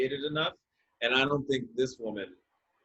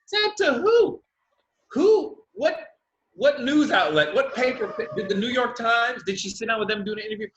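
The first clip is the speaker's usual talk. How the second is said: much higher and louder, half laughing; rapid-fire disbelieving questions; incredulous questioning; 7 semitones higher, 4 dB louder than usual. Retold or imitated. Questioning the woman's claims, loud incredulous high questions.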